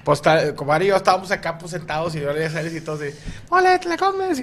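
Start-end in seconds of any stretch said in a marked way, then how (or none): 3.5-4.4 high mocking fan yell; mocking stadium heckle; heckler taunt. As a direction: taunt him like a fan in the stands.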